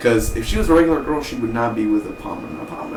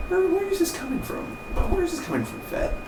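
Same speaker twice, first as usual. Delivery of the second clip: soft, very high falsetto; hushed, squeaky mock bewilderment; mock hurt friend; 7 dB quieter than usual. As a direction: plead in a tiny high voice. Acting out Emph confronted by Fett.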